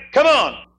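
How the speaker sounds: high, loud goading; taunting call. Acted. Drinking buddies goading Jim to keep drinking, loud. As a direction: egg him on across the table.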